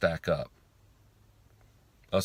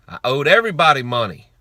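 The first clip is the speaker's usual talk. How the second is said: loud emphatic outburst; 6 semitones higher, 10 dB louder than usual. Real, not acted.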